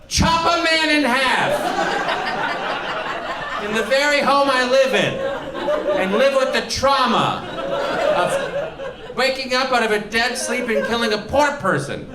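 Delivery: high, strained comic rant; overwrought protest. Acted. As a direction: cry out in mock horror at the very idea. Ranting about killing an intruder.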